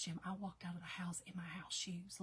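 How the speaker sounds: whisper. Retold or imitated. Retelling embarrassed aside to husband.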